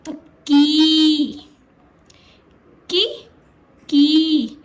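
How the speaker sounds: drawn-out loud की with long ee; long drawn-out वाली की. Shown as a demonstration. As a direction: stretch की slowly and loudly, long ee. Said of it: "तो ये कैसा उच्चारण करेगी?".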